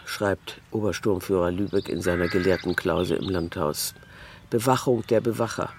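plain reading voice

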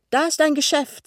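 high, loud exclamation; excited discovery. A narrator's reading. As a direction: call out the sudden sighting. Sie entdeckt das Geschäft, ruft. (aufgeregter Ausruf)

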